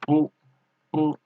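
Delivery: "bu" with a falling fourth tone. Shown as a demonstration. Teacher showing learners the tone of "bu", fourth tone. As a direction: say "bu" with a short falling pitch. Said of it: "for tone".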